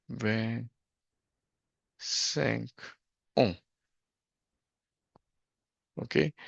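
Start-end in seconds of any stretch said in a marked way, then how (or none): 0.1-3.6 "vingt-cinq ans" broken up by long pauses. Shown as a demonstration. Dictating while writing it out.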